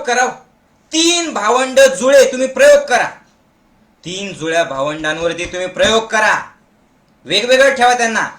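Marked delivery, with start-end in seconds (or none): none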